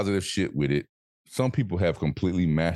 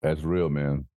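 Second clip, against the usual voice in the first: usual speaking voice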